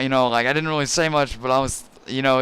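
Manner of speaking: usual speaking voice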